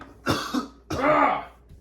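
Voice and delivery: loud throaty growl of mock hatred; exasperated growl. Teacher fed up with the kid, loud mock growl. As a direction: growl out a teacher's pent-up loathing.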